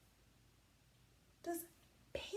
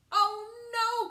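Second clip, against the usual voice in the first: high, loud mock cry; 10 semitones higher, 13 dB louder than usual. Wolf with boiled fur crying out, hammed-up. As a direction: wail it like the scalded wolf. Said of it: "cried the wolf".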